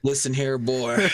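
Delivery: put-on Southern accent. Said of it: "heard you do a Southern accent".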